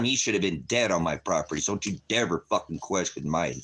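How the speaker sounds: normal talking voice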